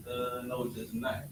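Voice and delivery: ordinary delivery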